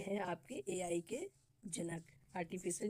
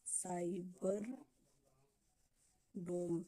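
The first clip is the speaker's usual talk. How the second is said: "Cyber डोम" drawn out, word by word; slow dictation; 3 dB quieter, much slower than usual. Shown as a demonstration. Teacher dictating the project name to students, slowly. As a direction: stretch "cyber", pause long, then "डोम".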